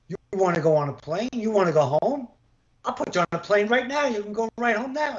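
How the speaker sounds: high, challenging retort; retold angry threat. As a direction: imitate the boss daring him to quit. President snaps back at umpire.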